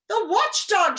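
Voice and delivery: high, loud panicked cry; frightened shout. A narrator's reading. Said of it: "shouted another".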